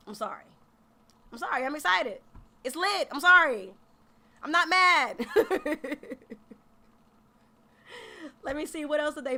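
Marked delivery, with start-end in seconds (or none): none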